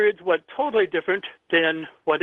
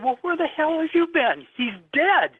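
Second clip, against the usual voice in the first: high, incredulous, grief-stricken snap; exasperated outburst; 11 semitones higher than usual. Retold or imitated. To his brother who hasn't heard the news, high exasperated outburst. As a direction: lash out at him in disbelief.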